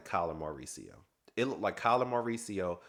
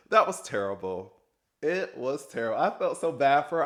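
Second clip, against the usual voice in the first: louder and higher, real indignation; genuinely worked-up outburst; outraged reaction; 6 semitones higher, 8 dB louder than usual. Real, not acted. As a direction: react loudly like you can't believe it.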